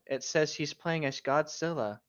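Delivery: ordinary delivery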